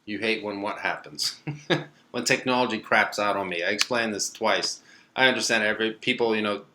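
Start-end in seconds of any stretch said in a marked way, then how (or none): none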